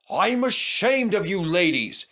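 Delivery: put-on stern old engine scolding. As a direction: scold them like naughty children. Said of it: "he scolded Agnes".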